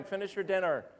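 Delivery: usual speaking voice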